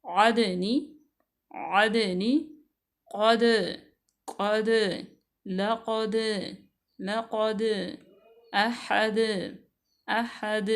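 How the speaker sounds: आदनी and क़द with the द bounced, a clear echo; qalqala. Shown as a demonstration. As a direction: pop the closing द and क़ with a jolt. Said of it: "दाल साकिना है".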